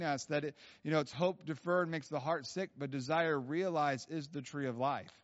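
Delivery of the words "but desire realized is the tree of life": usual speaking voice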